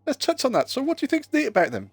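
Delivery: high teasing mock voice. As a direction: tease in a high, mocking voice.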